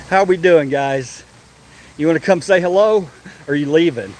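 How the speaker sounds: ordinary delivery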